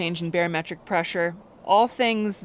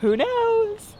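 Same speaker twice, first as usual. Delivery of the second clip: much higher, teasing who knows; playful tease. Real, not acted. Teasing husband about going into labor, playful lilt. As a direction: toss it off with a sly lilt.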